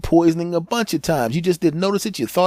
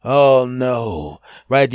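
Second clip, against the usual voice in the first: ordinary talk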